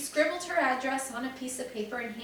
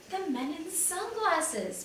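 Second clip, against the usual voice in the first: slow, drawn-out exclaim, pitched up; child blurting out a realization, excited; 7 semitones higher, slower than usual. A narrator's reading. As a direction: gasp out the discovery, slowly. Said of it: "Benny exclaimed".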